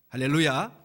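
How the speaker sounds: normal talking voice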